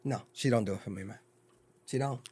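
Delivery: ordinary talk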